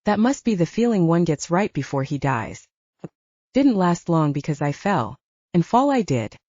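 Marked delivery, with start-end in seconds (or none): none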